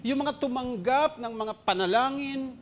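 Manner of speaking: normal talking voice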